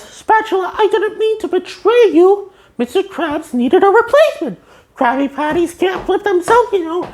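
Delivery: high pleading cartoon voice; SpongeBob voice. Reviewer voicing SpongeBob's apology, high and pleading.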